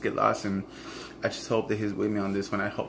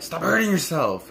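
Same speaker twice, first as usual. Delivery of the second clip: high, loud mock yell; angry outburst; 8 semitones higher, 5 dB louder than usual. Yelling at her addicted boyfriend, angry and high. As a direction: lash out at him.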